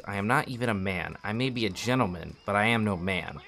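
narrating a tragic Russian novel; story narration. Acted. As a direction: read the novel aloud like an audiobook. Reads a book aloud.

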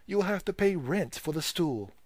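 put-on high, cheeky serving boy; impudent. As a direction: sass the customer. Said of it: "with much impudence".

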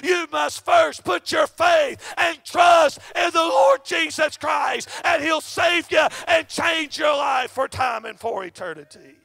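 loud, fervent, shouted sermon cadence; full-force preaching. Acted. Preacher calling the congregation to faith, shouting fervently. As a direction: hammer each line home to the congregation.

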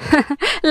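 high, playful giggle; joking little laugh; teasing laugh. Acted. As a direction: tease him with a laugh. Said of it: "نغم بهزار قالت".

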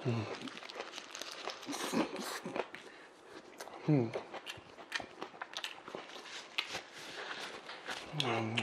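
chewing while humming; muffled through food; mouth full of sandwich. Real, not acted. Seen 0: Bites sandwich, cheeks full, chewing throughout; hand covers mouth mid-way.

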